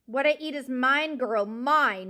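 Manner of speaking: loud possessive outburst; screaming owl. A narrator's reading. Owl threatening the mouse, loud scream. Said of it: "Mister Ocox screamed".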